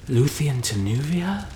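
awestruck, lilting up into a question; awed question. A narrator's reading. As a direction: ask it in wonder. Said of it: "the hobbits asked in awe".

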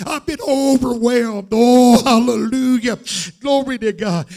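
exultant church shout; full-force preaching. Real, not acted. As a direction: cry out overwhelmed praise.

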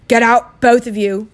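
loud croaked order. Acted. Uncle orders both boys out, croaking. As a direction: croak it out forcefully. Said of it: "croaked Uncle Vernon".

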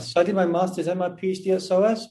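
ordinary talk, nothing marked

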